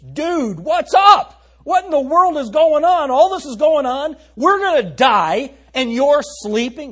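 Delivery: high, loud freaked-out yell; frantic disciples yelling in modern slang; panicked shouting. Acted. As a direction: shout it like a panicked guy waking his friend. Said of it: "That's what they're saying to Jesus".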